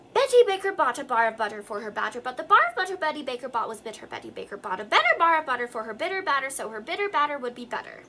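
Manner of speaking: quick, precise tongue-twister recitation; practice tongue twister. Shown as a demonstration. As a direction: rattle it off fast with clear consonants. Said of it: "I'll say the whole thing so you can practice".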